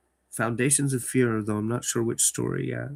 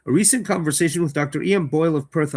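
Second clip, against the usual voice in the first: louder dramatic reading of the letter; story narration; 3 semitones higher, 5 dB louder than usual. Acted. Host reading the letter in the story aloud, dramatic narration. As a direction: open the letter with weight.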